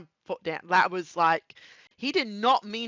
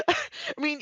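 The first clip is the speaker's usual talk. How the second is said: laughing. Real, not acted. Laughing at the accident.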